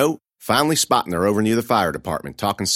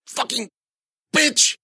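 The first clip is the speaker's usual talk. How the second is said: much higher, strangled cry of pain; pained curse; slower than usual. A narrator's reading. Kneed bully cursing the girl, a strangled yelp. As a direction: spit it out through the hurt.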